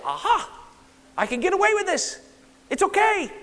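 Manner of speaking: high-pitched, triumphant sinner's voice; mock gleeful self-excusing. Preacher voicing people excusing little sins, high mock triumph.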